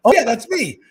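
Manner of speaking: high, loud re-enacted hello; enthusiastic greeting. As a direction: greet a fan back with big energy. Acting his happy reply to fan.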